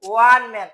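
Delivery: ওয়ান ম্যান said loud, starting with a w sound; pronunciation demo. Shown as a demonstration. Teacher showing students the w sound in one man, a loud demo. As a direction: call out ওয়ান ম্যান loudly with a clear w at the start. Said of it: "উচ্চারণটা কি আসছে?".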